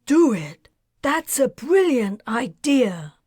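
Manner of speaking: loud, thrilled cry of approval; delighted outburst. Acted. Chertang thrilled by Bryce's plan, shouting with delight. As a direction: burst out with sudden glee at the plan. Smile broke out over face.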